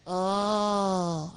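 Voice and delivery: long, held "Oh"; O sound. Acted. Sock repeats the letter for puppeteer, long held "Oh". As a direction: puppet sounding out "Oh" as one drawn-out vowel. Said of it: "Do it again".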